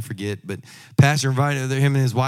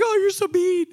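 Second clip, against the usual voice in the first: high, teasing wife's voice, much higher; wife's mock hurt. Wife scolding her husband in a high mock-hurt voice. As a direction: play the wife, high and teasing, pretend-wounded. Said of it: "she's like".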